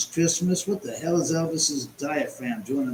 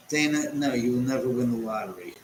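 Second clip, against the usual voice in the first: ordinary talk, nothing marked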